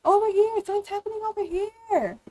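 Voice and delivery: high-pitched calling out for attention; pretend yelling for help. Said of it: "start yelling and be like".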